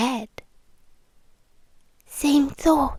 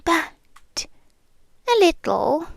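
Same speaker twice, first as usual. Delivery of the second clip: usual speaking voice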